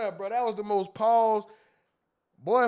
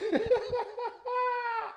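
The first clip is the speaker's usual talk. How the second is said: wild high laugh; high shrieking laugh; 6 dB quieter than usual. Real, not acted. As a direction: cackle at what he said. Laughs at friend's comment.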